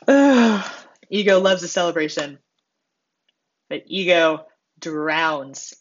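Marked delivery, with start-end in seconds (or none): 0.1-0.7 high, loud "ugh"; exasperated groan. Real, not acted. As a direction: groan at your own behavior. Exasperated at own ego.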